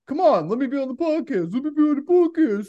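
high, pleading nag; whiny fan. Retold or imitated. Quoting a fan begging to join the podcast, high and pleading. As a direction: beg to be let on. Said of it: "This guy was like".

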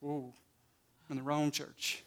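hushed, low mock aside; imitated worried churchgoer. Preacher imitating congregant hearing an unwelcome answer, hushed mock dismay. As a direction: drop right down, voicing a taken-aback listener. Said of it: "some of you was like".

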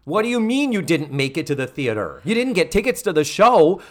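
building to high, loud indignation; mock rising alarm. Missus Garrett grilling Joe by phone, building mock outrage. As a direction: climb from firm questioning to hammed-up outrage.

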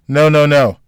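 emphatic, hasty protest; quick denial. A narrator's reading. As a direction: cut in quickly to correct him. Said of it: "Jack said quickly".

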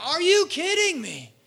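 high, slow, drawn-out complaint; mock a believer fed up with trials; exasperated disbelief. Preacher drags out a high whine at hard times. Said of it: "we're like".

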